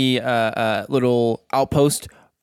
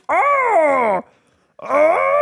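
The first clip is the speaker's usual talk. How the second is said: high-pitched drawn-out "oh" wail; two long high cries; creature's cry; 14 semitones higher than usual. Acted. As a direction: wail like a toppling beast, very high. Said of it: "put its arm up and go".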